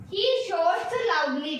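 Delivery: drawn-out reading of the example, word by word; slow, deliberate example sentence; slow dictation. Shown as a demonstration. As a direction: read it slowly so students can follow. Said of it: "ये देखो".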